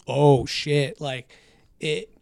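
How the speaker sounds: ordinary talk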